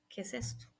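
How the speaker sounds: whispered, tense question; quiet whisper in the bathroom; hushed confrontation. Retold or imitated. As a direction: hiss it low so no one hears.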